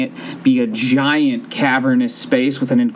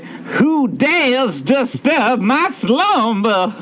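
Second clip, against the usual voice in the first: very high, slow booming cave voice; a giant cave spirit slowly waking; Cave of Wonders voice; 11 semitones higher, much slower than usual. Acted. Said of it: "It, it sounds like this".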